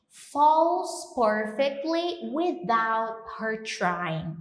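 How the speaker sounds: slow, careful English line; slow model reading. Shown as a demonstration. Teacher reading the lyric slowly to students. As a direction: read it slowly, word by word. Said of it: "teacher modelling".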